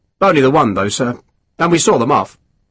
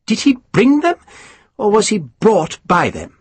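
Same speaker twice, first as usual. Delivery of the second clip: a higher, mannered gentleman's query; high aristocrat voice; 9 semitones higher than usual. Acted. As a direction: raise the pitch for the dainty lord. Pumphrey needles Sharp.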